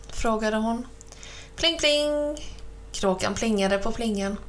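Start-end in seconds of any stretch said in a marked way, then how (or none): 1.6-2.4 high ringing bell imitation; bell pling. Narrator imitating the cow's bicycle bell, high and ringing. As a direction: chime it out like a little bell.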